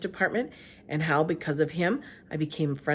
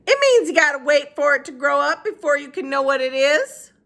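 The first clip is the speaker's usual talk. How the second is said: Gloria Dump voice; 9 semitones higher, 8 dB louder than usual. Acted. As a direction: speak up high and unhurried.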